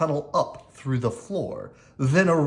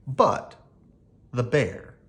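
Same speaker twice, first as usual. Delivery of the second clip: ordinary delivery, nothing marked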